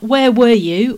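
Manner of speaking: accusing. Acted. The reproach Jesus did not make, sharp and accusing. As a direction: throw it at them like a blame.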